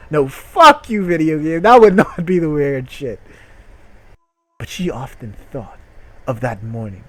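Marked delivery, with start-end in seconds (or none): 0.1-3.2 loud high joking rant; hammed-up tirade at the game; mock rant. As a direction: yell at the game in fake anger. Ranting at the video game.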